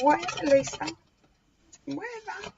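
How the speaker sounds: high, bratty cat voice dismissing Lisa; snooty cat brushing off his owner; voicing the cat Theo. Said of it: "Aren't they, Theo?".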